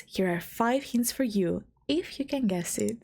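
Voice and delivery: ordinary delivery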